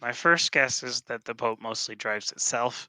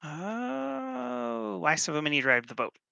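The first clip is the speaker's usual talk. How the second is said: high crow cry stretching Oh; squawky crow voice; 9 semitones higher, slower than usual. Acted. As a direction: caw it out like a parroting crow. Player voices her crow repeating.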